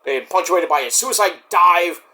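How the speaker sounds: ordinary delivery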